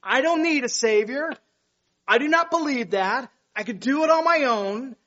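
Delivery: loud, high defiant voice; mock the proud rebel; defiant sinner. Preacher voicing a sinner who rejects the Savior, defiant.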